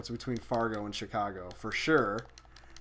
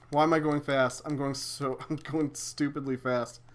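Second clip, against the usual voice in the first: usual speaking voice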